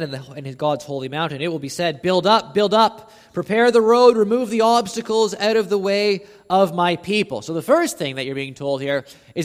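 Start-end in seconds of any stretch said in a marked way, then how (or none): 2.0-7.4 loud, rising call to clear the road; proclaimed command. Acted. Preacher to congregation, calling out the command loud. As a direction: call it out like a herald.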